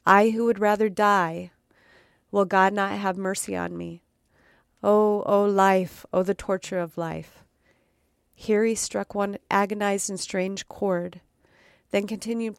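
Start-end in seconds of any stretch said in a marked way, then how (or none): 0.0-7.4 a hint of an anguished Count, pleading. The Count pleads with sleepwalking Gabriel, anguished.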